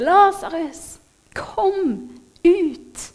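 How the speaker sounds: high, drawn-out call at restrained volume; llamada a voces. A narrator's reading. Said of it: "Jesus sto utenfor hans grav og ropte".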